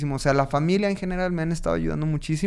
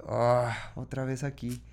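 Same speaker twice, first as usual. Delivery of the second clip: slow, softer, sighing retold letdown; dismayed sigh; 2 semitones lower, 7 dB quieter, slower than usual. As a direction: sigh it out, mildly downhearted. Retold dismay on returning home. (suspiro de desánimo, lento y bajo)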